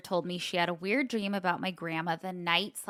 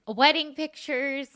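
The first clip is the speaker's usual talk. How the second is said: slow, choked-up crying; reading through tears; 6 semitones higher, 4 dB louder than usual. Real, not acted. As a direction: push the words through real tears. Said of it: "you're crying".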